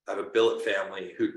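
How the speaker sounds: ordinary talk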